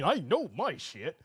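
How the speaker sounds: mocking quote. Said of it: "by typing".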